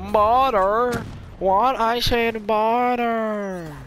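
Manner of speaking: high, loud, silly holler; hammed-up catchphrase yell. Vlogger trying out his new saying, hammed-up holler.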